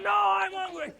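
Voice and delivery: high, whining protest; high whining complaint; whiny protest. Retold or imitated. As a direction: plead like a hungry child. Protesting to the witch doctor.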